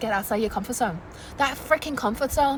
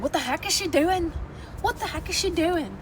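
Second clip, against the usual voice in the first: puzzled onlookers; 5 semitones higher, 4 dB quieter than usual. Retold or imitated. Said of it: "where people be like".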